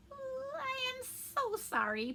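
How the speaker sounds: very high, drawn-out apology; apologetic. A narrator's reading. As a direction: plead slowly for forgiveness, pitched high. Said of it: "Pinocchio replied".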